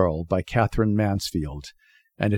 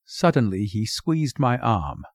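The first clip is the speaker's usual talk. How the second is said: usual speaking voice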